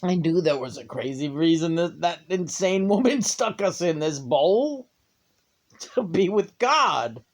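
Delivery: awestruck snail voice. Acted. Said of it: "And they'll be like".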